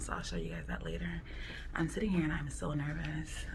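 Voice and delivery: nervous whisper; hushed in the waiting room. Real, not acted.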